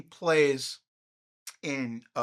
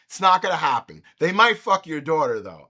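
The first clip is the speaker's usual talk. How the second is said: ordinary talk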